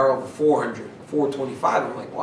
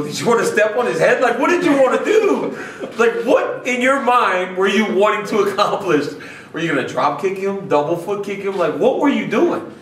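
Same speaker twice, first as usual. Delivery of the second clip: teasing incredulous; 6 semitones higher, 4 dB louder than usual. Retold or imitated. Teasing Khabib about his outburst.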